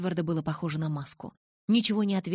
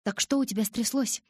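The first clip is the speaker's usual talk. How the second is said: lightly casual question, pitched higher; feigned indifference; 6 semitones higher than usual. A narrator's reading. Asking Jake while Edward eavesdrops, feigned indifference. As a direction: ask it as if it hardly matters. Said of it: "как можно равнодушнее спросила я".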